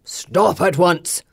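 slow, booming command from above. Acted. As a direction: boom it down at them. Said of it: "a voice booms out".

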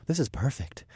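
soft breathy murmur; breathed. Acted. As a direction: breathe it out contentedly. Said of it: "I breathed".

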